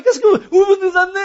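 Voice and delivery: fast, high, loud complaint; angry griping. Retold or imitated. Drivers led into a dead end, griping at him. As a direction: gripe loudly as an irate follower. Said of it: "les gens râlent après moi".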